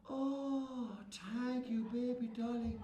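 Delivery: soft, grateful sigh of thanks; relieved thanks. Acted.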